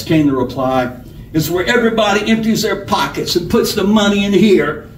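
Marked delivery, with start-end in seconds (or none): 1.3-4.9 higher-pitched robber character voice; bossy outlaw giving orders; bullying robber. A narrator's reading. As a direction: announce the hold-up like you own the room. Robber demanding money in story.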